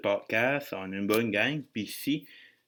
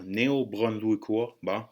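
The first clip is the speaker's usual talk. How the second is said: ordinary talk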